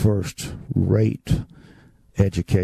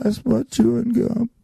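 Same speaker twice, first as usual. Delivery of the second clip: put-on high little-boy voice; innocent child; 12 semitones higher than usual. Boy explaining the gum to the house mother, high and innocent. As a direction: say it like a guileless kid.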